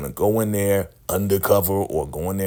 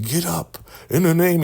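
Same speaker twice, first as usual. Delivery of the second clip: preaching cult leader; 8 semitones higher than usual. Retold or imitated. Cult leader commanding the wounded woman to rise, booming preacher. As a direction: call down power like a faith healer. Leader keeps repeating himself.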